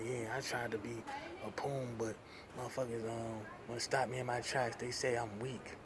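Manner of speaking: a hint of a sulking supervisor; whiny supervisor. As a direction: mimic the boss moping. Imitating whining supervisor.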